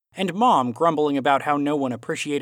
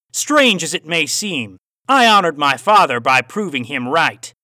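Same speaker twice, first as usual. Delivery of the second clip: louder, raised Aussie voice with a cowboy twang; Aussie cowboy accent; 5 semitones higher, 7 dB louder than usual. A narrator's reading. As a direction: play the villain Aussie, hint of cowboy twang. Villain's dialogue in story.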